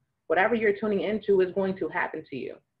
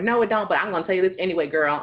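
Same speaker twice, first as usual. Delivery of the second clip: loud, fast acted girlfriend chatter; eager gossip; 2 semitones higher, 5 dB louder, faster than usual. Caller telling her girlfriend gossip anyway, loud and eager. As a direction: rush past the objection, louder.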